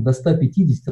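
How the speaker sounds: usual speaking voice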